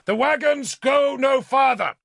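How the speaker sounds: loud, high commanding shout; shouting at her. A narrator's reading. Lord shouts at the princess, full command. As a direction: bark the order over the road. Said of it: "I shouted at her".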